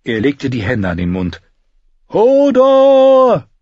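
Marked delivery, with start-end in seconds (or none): 2.1-3.5 long, high, loud call. Acted. Bran calls Hodor from afar, long, high and loud. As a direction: call across the woods with hands cupped. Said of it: "rief er".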